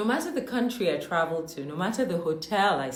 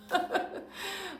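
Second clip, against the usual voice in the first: a quick high cackle of amusement; high bursting laugh; 13 semitones higher, 4 dB quieter than usual. Real, not acted. Laughing at her own cattle joke to viewers. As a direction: laugh at your own punchline.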